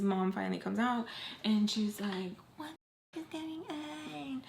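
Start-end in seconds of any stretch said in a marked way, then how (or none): 2.6-4.4 high, drawn-out mock yell, held back in volume; pissed-off mom. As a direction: imitate an angry mom shrieking softly. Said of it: "Like, she is pissed".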